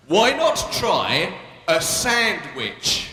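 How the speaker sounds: very slow, drawn-out slogan; slow mock ad. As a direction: drag out every word of the ad. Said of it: "a big poster in the window".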